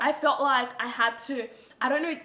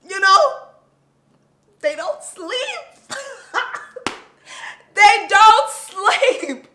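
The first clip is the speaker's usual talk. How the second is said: exasperated; 9 semitones higher, 13 dB louder, slower than usual. Real, not acted. Exasperated about baby not sleeping.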